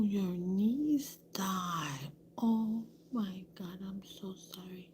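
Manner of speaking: slow, hushed, stricken whisper; slow soft whisper of sympathy; shocked whisper. Retold or imitated. Learning of niece's death.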